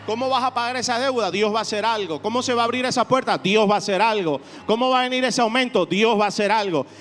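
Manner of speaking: loud, rising preacher's call; preaching at full force. Acted. Pastor firing up the congregation, loud preaching. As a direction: drive the congregation with each question.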